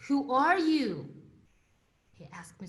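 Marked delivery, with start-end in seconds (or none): 0.1-1.1 slow, louder startled question; surprised question. Retold or imitated. Museum guide startled by the speaker's Russian, surprised. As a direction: ask it as if caught off guard.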